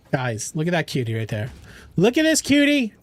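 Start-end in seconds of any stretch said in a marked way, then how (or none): 2.0-2.9 excited. Real, not acted. Gushing over a cute picture.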